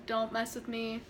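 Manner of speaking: usual speaking voice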